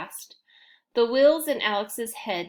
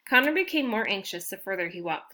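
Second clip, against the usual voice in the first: usual speaking voice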